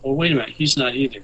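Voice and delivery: ordinary talk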